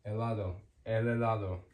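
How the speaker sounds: "Helado" as eh-LAH-do, no h breath; silent H. Shown as a demonstration. Teacher confirming the rule for learners, "el helado" with silent H. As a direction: run "el helado" straight from L into the vowel. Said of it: "The H is always silent".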